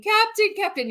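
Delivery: high mock yell of Captain; imitating Guapo screaming. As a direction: cry out like Guapo in a panic. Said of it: "Guapo screaming".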